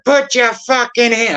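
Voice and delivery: high, slow mock police shout; imitated bogus officer's command; fake cop. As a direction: order it like a pretend cop on a raid. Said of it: "acting like he's a cop".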